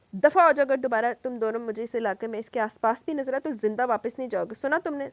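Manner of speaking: loud, raging threat; furious yell. Acted. Salar threatens the fleeing thugs, yelling in anger. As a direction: bellow the warning with fury. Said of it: "غصے میں بھرا ہوا چلا رہا تھا".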